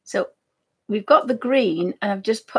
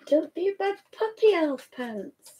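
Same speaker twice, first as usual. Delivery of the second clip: dog talk; 7 semitones higher than usual. Real, not acted. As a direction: coax the puppy. Talking to dog.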